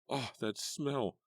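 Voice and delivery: high, slow groan of disgust. A narrator's reading. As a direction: recoil from the stench. Disgusted by smell.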